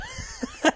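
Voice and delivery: small awkward giggle; nervous laugh. Real, not acted. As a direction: giggle it off self-consciously. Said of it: "that's my bit of a nervous laugh there".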